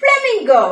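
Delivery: bright emphatic model word; clear teaching word. Shown as a demonstration. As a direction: call flamingo out high and clear for children to repeat. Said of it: "പറഞ്ഞേ".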